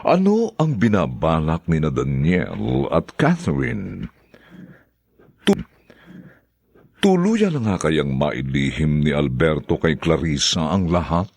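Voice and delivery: low, measured teaser for next episode; radio drama narrator. Acted. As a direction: leave listeners hanging.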